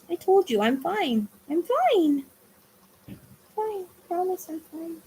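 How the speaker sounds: pet talk. Real, not acted. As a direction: reassure the puppy sweetly. Reassuring the dog.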